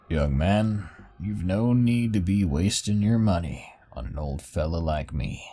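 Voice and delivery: put-on a kindly old fella; old man voice. Old bar acquaintance speaks.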